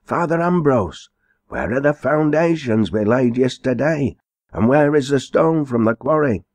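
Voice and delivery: a worried workman hailing Father Ambrose, high and urgent; mason crying out. Acted. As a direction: call out to the priest, voice up high. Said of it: "a mason cried out".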